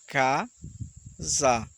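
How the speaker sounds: Ca-za, two beats split apart; Ca-za syllable by syllable. Shown as a demonstration. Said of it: "ao pronunciar casa, você pronuncia duas vezes".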